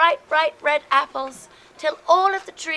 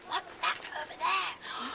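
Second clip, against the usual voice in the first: hushed wonder; 13 dB quieter than usual. Acted. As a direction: drop to a hushed voice, spotting something. Storyteller hushed discovery.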